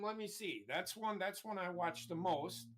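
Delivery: ordinary talk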